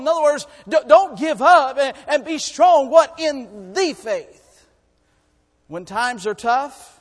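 loud, high, building sermon voice; full-force preaching. Acted. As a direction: preach it out to the whole church.

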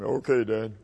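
put-on boy forcing his voice down low. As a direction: drop the pitch in mock-manly obedience. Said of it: "lower your voice".

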